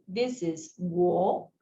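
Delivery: wo drawn out slowly; slow model of wo. Shown as a demonstration. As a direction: say "this is wo" very slowly for learners. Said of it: "trying to pronounce together with me".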